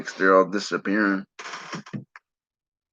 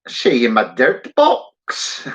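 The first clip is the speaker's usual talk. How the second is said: slow, hammed-up Irish accent; drawn-out put-on Irish accent; a joking stab at an Irish accent; mock Irish accent; 3 semitones higher, much slower than usual. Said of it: "I can do, like, a, um, Englishman".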